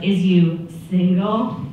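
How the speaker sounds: slow mock suitor's question; drawn-out chat-up. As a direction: stretch it out as you imitate him. Man's awkward chat-up question retold.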